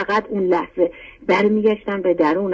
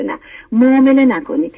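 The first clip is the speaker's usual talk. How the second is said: louder and higher, pressing the point hard; emphatic no; 5 semitones higher, 4 dB louder than usual. Real, not acted. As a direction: insist firmly on «نه، معامله نکنیم». Urging listeners not to bargain. (با تأکید و صدای بلندتر)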